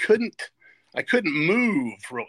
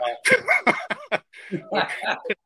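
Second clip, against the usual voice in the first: laugh; 4 dB louder than usual. Real, not acted. Laughs on meeting Bear Bryant.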